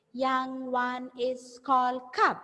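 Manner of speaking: slow, evenly spaced teacher modelling; slow repeat-after-me. Shown as a demonstration. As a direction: say it word by word for pupils. Model sentence for pupils to repeat.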